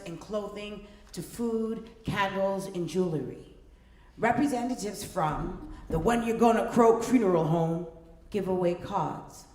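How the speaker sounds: usual speaking voice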